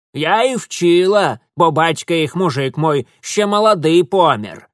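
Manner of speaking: loud, high dialect-speaking granny; feisty old village woman, scolding and boastful. Acted. Old woman orders Mitya to row, loud scolding. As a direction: scold and brag at full voice.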